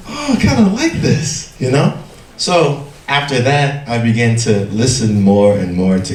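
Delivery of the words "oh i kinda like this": high-pitched reenacted delight; pleasantly surprised. Teacher retelling first time hearing K-pop, high pleasantly surprised reaction. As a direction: act out a sudden, high-pitched liking.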